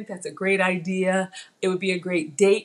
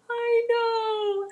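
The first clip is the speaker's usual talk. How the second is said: high squeal; 14 semitones higher than usual. Real, not acted. Reacting to chat.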